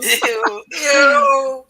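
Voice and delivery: loud grossed-out shriek; disgusted. Real, not acted. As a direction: recoil in disgust. Disgusted reaction.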